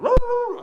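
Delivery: sudden high, loud yelp of a bark; dog bark. Retold or imitated. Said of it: "the dog start barking. Like".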